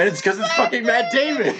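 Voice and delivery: high exclamation through real laughter; laughing punchline. Real, not acted. Revealing the actor was someone else, laughing hard. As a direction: burst out with the punchline, cracking up.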